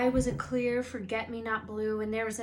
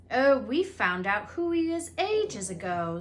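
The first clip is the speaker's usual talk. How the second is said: impressively; 3 semitones higher, 7 dB louder than usual. A narrator's reading. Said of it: "said Ron impressively".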